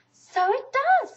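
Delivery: loud, high, slow pleased discovery; delighted Pooh. A narrator's reading. Pooh sees the balloon fit in the pot, delighted. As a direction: marvel at it out loud.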